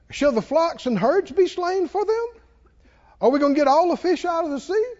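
high-pitched sarcastic questioning. Retold or imitated. Preacher reads Moses questioning God, sarcastic. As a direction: ask in mock disbelief, pitch lifted. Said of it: "See, that's sarcastic".

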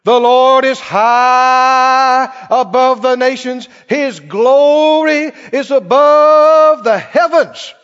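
loud, drawn-out proclamation; preaching scripture. Acted. Preacher boasting on the Lord to the congregation, full-voiced preaching. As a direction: stretch the key words out in a powerful, slow declaration.

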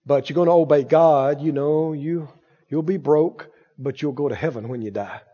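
low, soft, dismal promise; mock resigned. Preacher voicing a lie about obeying God, mock resigned. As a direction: sink into a subdued mock-pious consolation.

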